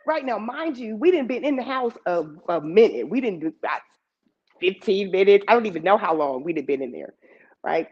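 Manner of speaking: normal talking voice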